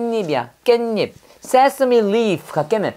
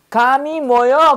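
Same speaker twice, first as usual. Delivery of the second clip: imitating grandma asking; 5 semitones higher, 3 dB louder than usual. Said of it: "그러는 거야".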